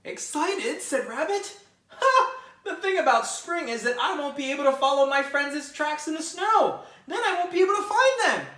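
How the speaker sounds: sarcastic bunny dreading spring; scoffing rabbit. Acted. Rabbit's scornful reply.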